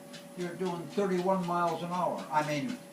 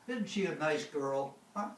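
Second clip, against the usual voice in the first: usual speaking voice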